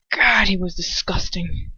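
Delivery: ordinary delivery, nothing marked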